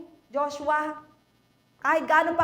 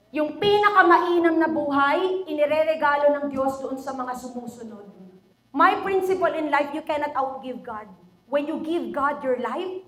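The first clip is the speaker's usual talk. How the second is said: usual speaking voice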